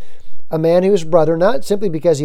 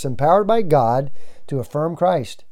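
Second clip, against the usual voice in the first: ordinary delivery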